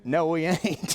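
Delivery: laughing flat-out no; laughing refusal. Retold or imitated.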